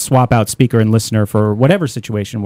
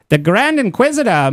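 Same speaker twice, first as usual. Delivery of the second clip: lightly voiced old-time radio announcer, pitched up; a hint of forties radio patter, higher; forties radio guy; 8 semitones higher than usual. Botched Spanish Inquisition voice, coming out as forties radio announcer. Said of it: "that was forties radio guy".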